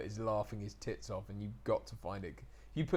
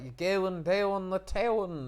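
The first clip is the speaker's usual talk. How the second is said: slow, higher broad Norfolk drawl; Norfolk accent; 8 semitones higher, 5 dB louder, slower than usual. Shown as a demonstration. Speaker imitates a Norfolk local, broad Norfolk accent. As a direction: stretch the vowels like a Norfolk local. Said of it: "They add syllables where there's a W".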